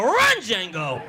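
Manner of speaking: laughing as he calls it; laughing cheer. Retold or imitated. Comic repeating his cheer, laughing. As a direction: call it through laughter.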